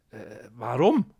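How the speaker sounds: slow, loud, bewildered question; baffled. Acted. The scientist asks the cat why, slowly and baffled. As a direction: drag it out in disbelief.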